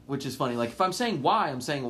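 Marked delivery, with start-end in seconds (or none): 1.2-1.5 "why" with the glide flattened to "wah"; Southern why. Shown as a demonstration. Explaining a Southern habit, "why" said as "wah". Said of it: "I say certain words Southern".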